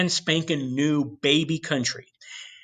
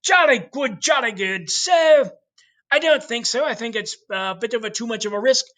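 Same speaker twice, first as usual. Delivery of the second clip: mock posh English; 8 semitones higher than usual. Teacher voicing the English court questioning Columbus, high mock British accent. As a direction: ham up an upper-class British twit. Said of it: "And the English go".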